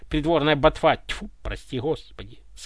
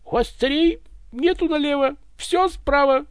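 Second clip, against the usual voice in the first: joking; 11 semitones higher than usual. Acted. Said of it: "опять схохмил Иван".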